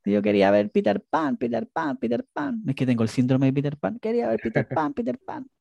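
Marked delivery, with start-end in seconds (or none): 0.1-2.6 put-on high, whining kid repeating Peter Pan; retelling her childhood sulk to her co-host, in a high whining child voice; whiny child. Retells childhood whining about movie. 4.0-5.5 put-on high, whining kid repeating Peter Pan; retelling her childhood sulk to her co-host, in a high whining child voice; whiny child. Retells childhood whining about movie.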